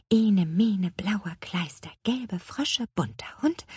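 whispery muttered rhyme; murmured to herself. A narrator's reading. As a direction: mumble the spell under your breath. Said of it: "murmelte Petronella unablässig vor sich hin". (vor sich hin gemurmelt)

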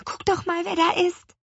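put-on high nervous child voice; nervous girl. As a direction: Guck doch mal said high and anxious. Girl nervously alerts father.

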